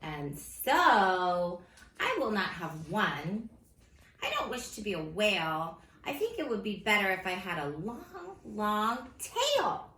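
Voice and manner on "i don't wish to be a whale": ordinary delivery, nothing marked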